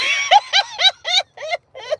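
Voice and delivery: shrill-pitched cackle after the punchline; high cackling laugh. Real, not acted. Laughing at her own joke.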